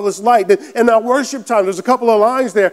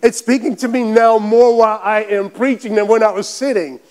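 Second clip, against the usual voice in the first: normal talking voice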